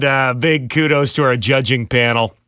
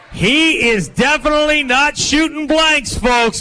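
hype shouting; 10 semitones higher than usual. Real, not acted. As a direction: pump up the crowd, shouting. Hyping crowd for winner.

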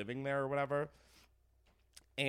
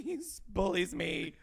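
mock sobbing; 8 semitones higher, 5 dB louder, slower than usual. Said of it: "fully sobbing".

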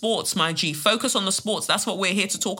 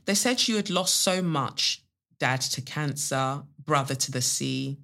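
measured poetry reading with long pauses; poem recital; 4 semitones lower, 4 dB quieter, slower than usual. Acted. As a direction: let each line fall softly and settle. Reads listener's poem aloud.

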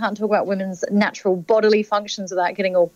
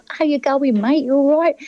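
breezy Cockney greeting, a touch higher; lightly put-on Cockney matey hello; 5 semitones higher than usual. English-born panellist imitates a Cockney greeting lightly. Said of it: "that kind of Cockney".

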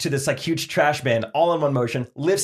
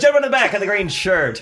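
auctioneer; 7 semitones higher, 4 dB louder than usual. Pretend. Pointing out a bidder.

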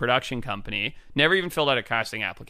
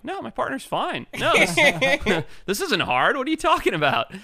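higher, laughing disbelief; mock incredulous; 13 semitones higher than usual. Brushing off the producers' pressure with high, laughing disbelief. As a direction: laugh off the question as absurd. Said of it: "I'm like".

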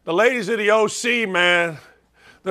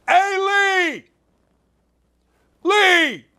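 high, drawn-out holler; calling through house; 10 semitones higher, much slower than usual. Acted. Husband hollering for help at home, calling through house. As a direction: yell for someone in another room, acted out. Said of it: "Here's what you're gonna hear in my house".

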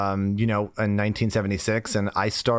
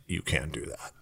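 stern dad; 6 semitones lower, 9 dB quieter than usual. Retold or imitated. Dad scolding him in office.

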